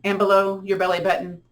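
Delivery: slow, drawn-out counting through each breath; guided breathing count. Acted. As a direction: pace them gently through inhale and exhale. Leads paced breathing with counting.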